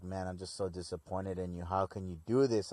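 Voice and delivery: low, soft, let-down reproach; imitating a disheartened mentor, low and subdued; mock disappointment. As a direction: act out quiet disappointment in someone. Voicing Gowasu's disappointment in Zamasu.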